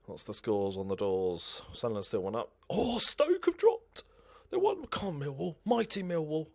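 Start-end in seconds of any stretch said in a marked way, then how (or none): 2.7-6.5 excited. Real, not acted. Reacting to rival results.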